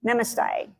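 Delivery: Namaste said softly, ending in "stay"; trying out pronunciation. Real, not acted. Host checking how to say a greeting, careful pronunciation. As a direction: quietly repeat Namaste in three syllables, falling at the end. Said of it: "Is that how you say it?".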